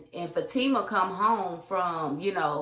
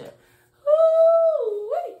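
shocked whoop; 10 dB louder than usual. Real, not acted. Reacting to hot tub reveal.

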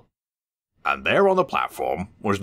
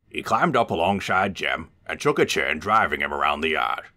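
ordinary delivery

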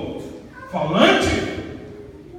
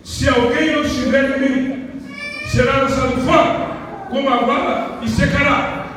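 preaching scripture; 5 semitones higher, 6 dB louder than usual. Acted. Scripture declaimed.

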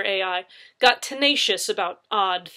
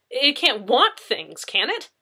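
1 incredulous; 6 semitones higher, 7 dB louder, slower than usual. A narrator's reading. Incredulous officer.

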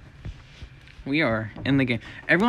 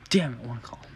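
hushed frustrated whisper; 8 semitones lower, 8 dB quieter than usual. Real, not acted. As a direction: whisper it under your breath. Muttering while playing alone.

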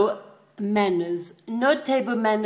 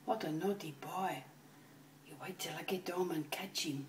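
hushed mock threat; a whispered promise of trouble; 4 semitones lower, 17 dB quieter than usual. Real, not acted. Owner whispering a threat at the naughty dog.

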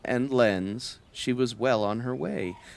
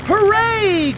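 high, loud cheer; joyful cry; 7 dB louder than usual. Acted. The cow cheering as they fly, high and loud. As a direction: cheer as the plane lifts. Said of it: "cried the cow".

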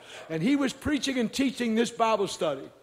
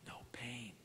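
soft unvoiced whisper, much lower and softer; 9 semitones lower, 18 dB quieter than usual. Wife whispering to the preacher that her pain is gone. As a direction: breathe it out barely audible. Wife quietly answering after healing.

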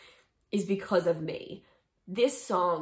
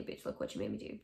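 mock sass; 11 semitones lower, 8 dB quieter, faster than usual. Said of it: "I also can't say".

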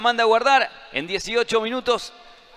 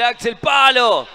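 loud, drawn-out post call; excited shout; 3 semitones higher, 8 dB louder, much slower than usual. Real, not acted. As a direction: cry out as it hits the post. Shot hits the post.

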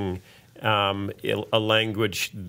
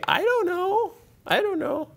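falsetto I don't know, twice; comic falsetto shrug; high silly voice. Acted. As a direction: squeak it out comically. Comic high-pitched repetition.

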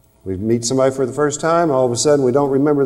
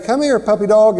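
coaxing call to a puppy, pitched higher and a bit louder; calling a puppy to come over in a nice tone; 7 semitones higher, 4 dB louder than usual. Shown as a demonstration. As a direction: coax the dog sweetly. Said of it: "loving words with a nice tone of voice".

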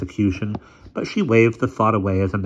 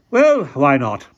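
put-on slow, loud, imperious old lady; 7 semitones higher, 8 dB louder, slower than usual. A narrator's reading. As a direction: speak like a commanding elderly aunt.

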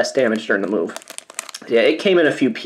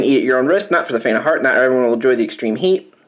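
ordinary talk

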